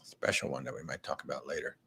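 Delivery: a soft conspiratorial repeat, almost under the breath; hushed teasing echo. Real, not acted. Host echoing his wife's hint about a secret cruise, hushed and teasing. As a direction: drop way down and murmur it back like a secret.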